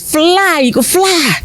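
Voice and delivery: high, ringing call to the bird; a soaring high-pitched plea to fly. Acted. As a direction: urge the eagle skyward with a lifted cry. Friend tosses eagle into air.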